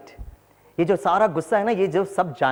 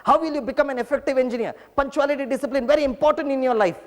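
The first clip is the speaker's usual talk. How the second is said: raised, slow scolding of late students; mock angry lecture; 8 semitones higher, slower than usual. Angry teacher scolding latecomers, hammed-up. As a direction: scold them slowly, pitch up high. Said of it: "मैं इतना भड़कता था".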